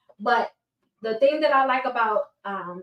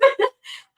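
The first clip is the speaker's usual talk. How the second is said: short laugh; 13 semitones higher, 4 dB louder than usual. Real, not acted. Embarrassed about her braces.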